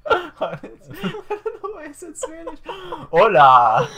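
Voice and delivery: high, loud laughing through words; cracking up at a silly slip. Real, not acted. Mixing up languages before singing, laughing high and loud.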